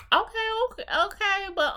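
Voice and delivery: taken aback. Retold or imitated. Host reacts in surprise to a date wanting kids. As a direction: caught off guard, stalling.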